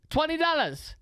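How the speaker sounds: high, projected reply across the street; calling back. Retold or imitated. Restaurant guy answering from across the street, calling back. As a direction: imitate a vendor hollering a price.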